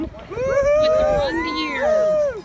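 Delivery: loud outdoor announcing; projecting over the crowd noise; hoarse marathon cheering. Real, not acted. Cheering runners at mile twenty-one.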